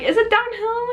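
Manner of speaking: high-pitched retold question; squeaky-high retold query; worried question. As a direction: ask it up high, imitating your younger self. Asking friend while riding.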